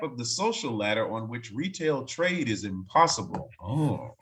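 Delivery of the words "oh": ordinary delivery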